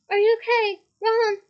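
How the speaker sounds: loud worried call. A narrator's reading. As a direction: shout to him through the wall. Calling through a rock wall.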